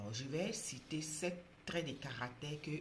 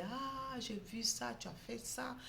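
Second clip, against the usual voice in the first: high-pitched, drawn-out 'ah' of a busybody; mock private critic; 9 semitones higher than usual. False friend messaging privately after seeing a post, mock high-pitched remark. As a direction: imitate a critic in a higher voice.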